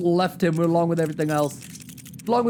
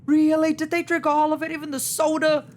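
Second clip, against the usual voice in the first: indignant disbelief; 7 semitones higher than usual. Real, not acted. Dismay at empty bottles.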